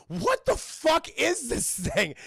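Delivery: slow, high, incredulous question; hammed-up horrified disbelief; appalled wife. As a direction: imitate a wife stretching out her disbelief. Appalled wife sees the plastic leg.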